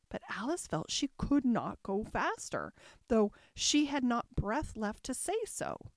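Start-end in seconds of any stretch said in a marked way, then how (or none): none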